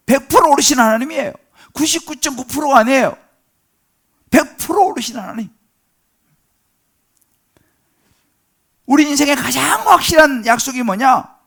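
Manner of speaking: loud, high, impassioned pulpit delivery; full-force preaching. Real, not acted. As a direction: proclaim it forcefully to the congregation. (높고 힘차게 외치는 설교)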